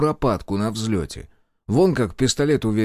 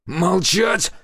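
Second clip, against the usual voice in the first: sharp, high-pitched bark; barked order; 9 semitones higher, 4 dB louder than usual. A narrator's reading. Major orders everyone silent at gunpoint, barking. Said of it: "рявкнул Комаров".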